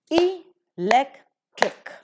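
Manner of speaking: "electric" very slowly as three pieces, e-lec-tric; syllable by syllable. Shown as a demonstration. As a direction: break "electric" apart with pauses between syllables. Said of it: "trisyllabic word it is".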